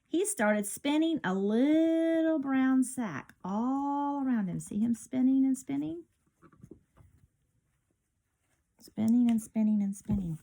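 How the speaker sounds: lively storybook read-aloud; bright picture-book narration; reading to children. A narrator's reading. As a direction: read it like storytime for little kids.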